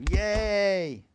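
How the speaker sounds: loud, high, drawn-out cheer. Pretend. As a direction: whoop for the sponsor. Cheers the sponsor announcement.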